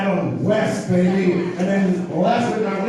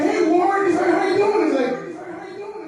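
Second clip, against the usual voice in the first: high squeaky voice. Retold or imitated. Greeting, high-pitched.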